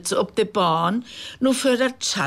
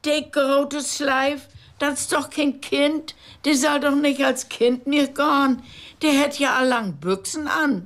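railway clerk; 8 semitones higher than usual. A narrator's reading. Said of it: "Der Isenbahner mockt nu Wind".

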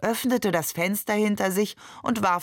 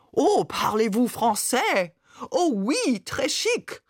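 slow, rising, higher hammed-up French accent; mock French accent; 4 semitones higher, slower than usual. A narrator's reading. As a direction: parody Maman's French accent, prissy and slow. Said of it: "übertrieben Mamans französischen Akzent nach". (übertriebener französischer Akzent, spöttisch)

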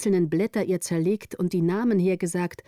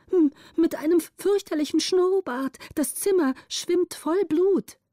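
high, stammered start, dramatic dread; horrified report; 10 semitones higher than usual. A narrator's reading. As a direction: report it aghast, drawing out the horror. Lookout describes horror in fright. (entsetzt und hoch berichtet)

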